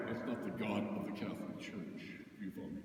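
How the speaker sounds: a hushed whisper. Real, not acted. Priest leaning in to congregation, whispering. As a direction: drop to a whisper to drive it home.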